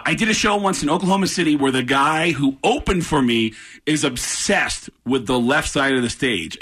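ordinary delivery

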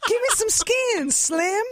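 mock groovy seventies hipster greeting; seventies jive talk. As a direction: greet someone like a time traveler fresh from the disco era. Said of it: "I sound like the equivalent of someone who, like, time traveled".

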